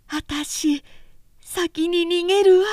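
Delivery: put-on high, pleading girl's voice rising to a tearful cry; a panicky girl begging her sister to flee; frightened younger sister. A narrator's reading. Oyoshi urges her older sister to flee, high and tearful.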